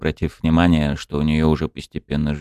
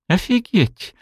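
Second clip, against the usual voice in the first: high amazed cry; amazed exclamation; slower than usual. A narrator's reading. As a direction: blurt it out wide-eyed. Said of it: "вытаращив глаза, выкрикнула волчица".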